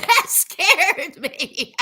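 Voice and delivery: slow words breaking into long laughter; laughing after a scare. Real, not acted. Laughing at herself after the moth scare, laughing. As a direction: laugh off a real fright, trailing away low.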